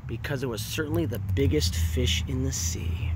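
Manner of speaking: whispered narration; storyteller whispering to the children. A narrator's reading. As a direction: breathe it like a secret. Narrator hushes the line.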